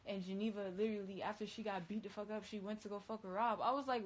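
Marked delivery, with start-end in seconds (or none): none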